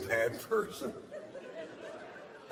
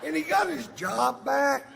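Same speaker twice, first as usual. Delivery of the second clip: escalating comedy rant; 6 semitones higher than usual. Acted.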